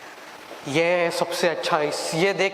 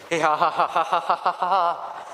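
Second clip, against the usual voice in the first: evil laugh; slower than usual. Acted. Devil gloating over his catch, evil cackle. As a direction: cackle like a demon who just caught his prey.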